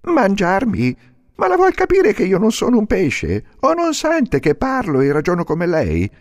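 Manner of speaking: più alto, protesta; burattino indignato. Acted. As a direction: protesta incredulo. (burattino che protesta, voce alta)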